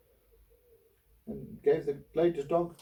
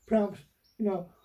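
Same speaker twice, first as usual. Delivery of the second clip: higher, hesitant, giving in; hesitant shopkeeper; 9 semitones higher, 5 dB louder than usual. Retold or imitated. Shopkeeper conceding fifty p to the customer, higher hesitant voice. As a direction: shopkeeper offers a small discount, high and hesitant. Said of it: "And he said".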